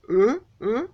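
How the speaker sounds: imitating a flustered man's stammer. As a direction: caught off guard, fumbling for words. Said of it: "And then he was flustered".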